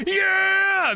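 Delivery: high shouted yeah; crowd yell. Acted. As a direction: yell like a brawling mob. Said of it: "go like".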